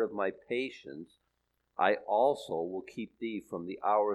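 measured, solemn Bible reading; scripture reading. Acted. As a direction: read the verses like holy words. Reads scripture aloud.

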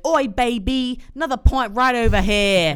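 loud, high Cockney pub shout; mock Cockney drinker calling out; Cockney accent. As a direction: bellow for service in hammed-up Cockney. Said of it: "He's got that Cockney accent".

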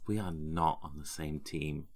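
low, quiet cold menace; glaring hostility. Acted. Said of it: "glare daggers at her".

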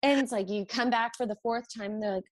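ordinary talk, nothing marked

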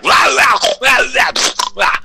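high, loud mock wailing wah; mock crying wah. Imitating a crybaby wail at the kids. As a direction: whine like a fussing kid. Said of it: "and I'm like".

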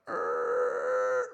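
low, buzzing croak imitation; half-hearted animal noise; mock frog croak. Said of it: "I know it doesn't even sound like that".

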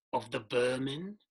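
a hint of a ghostly voice from the dark; echoing dark voice. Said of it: "A final voice".

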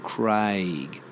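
CRAIG drawn out, soft G; long AY in Craig, easing into the G; slow "Craayg" for Craig; softened ending. Shown as a demonstration. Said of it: "the one G on the end softens the word".

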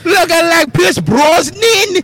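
badly faked Aussie, half Scottish; Australian accent. Shown as a demonstration. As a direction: stretch the taunt in a put-on Australian accent. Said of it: "I can pull an Australian accent".

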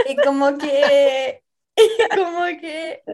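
laughing through the words; giggling while speaking. Real, not acted. Laughing while telling story.

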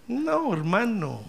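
ordinary talk, nothing marked

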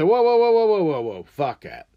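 loud, high whoa to halt the band; mock stop-the-take call. Stopping the band mid-song.

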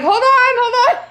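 high, loud and very fast, laughing; flustered mom yelling through the door at her knocking son; panicked call. Retold or imitated. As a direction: blurt it out through the door in a rush.

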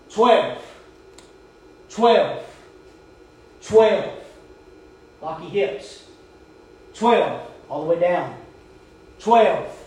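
loud barked count, falling each time. Retold or imitated. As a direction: bark the count like a drill sergeant. Counting push-ups loudly.

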